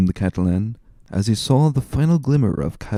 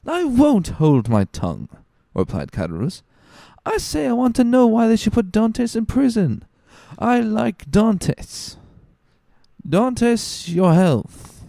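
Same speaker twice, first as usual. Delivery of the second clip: high, slurred tipsy tailor insisting; a drunk man getting stubborn over his wine; tipsy, much higher voice raising a toast; drunken Caderousse; 14 semitones higher than usual. Acted.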